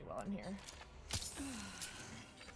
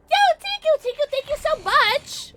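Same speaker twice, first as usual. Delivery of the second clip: excited thanks; 8 dB louder than usual. Real, not acted. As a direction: gush with excitement.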